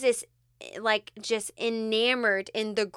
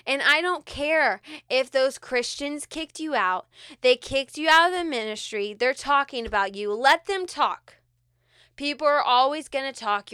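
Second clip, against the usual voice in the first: higher and louder, pressing the point home; impassioned preaching; 4 semitones higher, 5 dB louder than usual. Acted. Pastor to a rejected listener, fervent preaching. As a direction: preach it with conviction, building.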